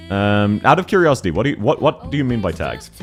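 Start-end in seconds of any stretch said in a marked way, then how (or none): none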